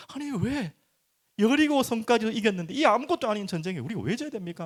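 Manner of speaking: acted-out disbelief, pleading to know why; Joshua, dumbfounded. Preacher voices the defeated leader questioning God after losing, dumbfounded. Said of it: "여호수아가 너무 황당한 거죠".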